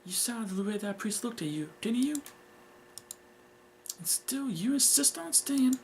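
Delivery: high, reproachful mother voice; scolding mother. Acted. Mother questions daughter's wish to stay, scolding. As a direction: scold with pitch climbing high.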